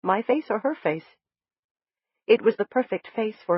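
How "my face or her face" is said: ordinary delivery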